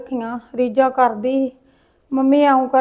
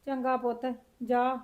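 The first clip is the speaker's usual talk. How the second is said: much softer, tearful resignation; tearful grandmother; 13 dB quieter than usual. Acted. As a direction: weep quietly as you send the boy home. Grandmother weeping over lost daughter. (ਰੋਂਦੀ ਹੋਈ ਹੌਲੀ ਦਾਦੀ)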